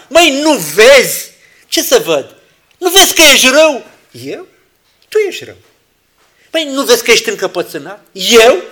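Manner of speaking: loud, high bickering; mock quarrel. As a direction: hurl the accusation back. Acted quarrel.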